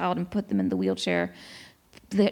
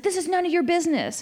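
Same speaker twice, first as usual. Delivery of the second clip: higher, fast imitation of a hostile man; mock angry retort; 9 semitones higher, faster than usual. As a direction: fling it back fast like the rude stranger. Angry man retorting again, retold.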